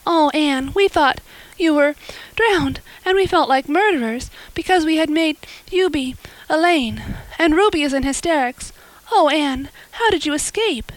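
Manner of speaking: put-on high, rushed girlish voice near tears; tearful relief. A narrator's reading. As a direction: pour it out, half weeping with relief. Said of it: "weeping with relief and delight".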